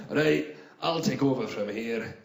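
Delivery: low, slow hard-man Scot; deep, unhurried tough guy; tough first-aid guy. Acted. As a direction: take over like a bouncer. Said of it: "toughest first aid guy I've ever seen".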